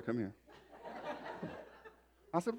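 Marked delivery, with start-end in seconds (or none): 0.0-0.3 much softer, lower call to come over; hushed summons. Retold or imitated. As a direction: imitate a wife's discreet, quiet beckoning. Wife beckons him over, first time.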